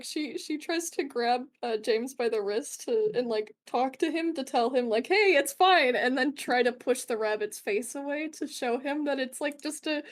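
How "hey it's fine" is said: higher, louder quick reassurance; raised-voice reassurance; urgent reassurance. Acted. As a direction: call out to calm someone down. Acting out reassurance to James.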